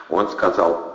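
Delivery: ordinary delivery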